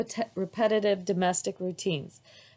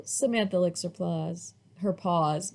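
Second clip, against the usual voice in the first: ordinary delivery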